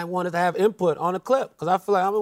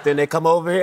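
ordinary talk, nothing marked

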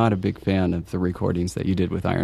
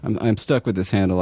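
normal talking voice

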